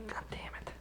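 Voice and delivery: whispered curse under the breath; whispering. Real, not acted. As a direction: hiss it out in a whisper. Muttered curse after mic trouble.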